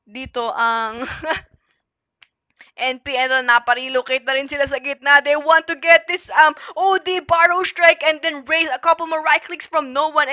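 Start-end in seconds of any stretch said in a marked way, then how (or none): 3.1-10.3 fast, loud, high excited casting; live play-by-play. Acted. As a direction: call the teamfight at full speed.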